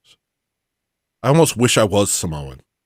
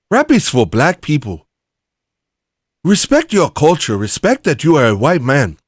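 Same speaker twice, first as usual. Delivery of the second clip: put-on loud, higher-pitched African accent; 5 semitones higher, 4 dB louder than usual. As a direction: scold in a put-on African accent, punching the words. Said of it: "I would use an African accent".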